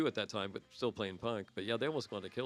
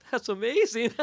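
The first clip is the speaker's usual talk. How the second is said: excited; 10 semitones higher, 7 dB louder than usual. Retold or imitated. Band reacting to outrageous idea.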